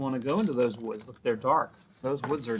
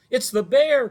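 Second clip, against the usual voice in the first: high, loud terrified cry; boy cries out at the bear; frightened cry; 11 semitones higher, 10 dB louder than usual. A narrator's reading. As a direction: shriek it in panic. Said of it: "cried Eddie".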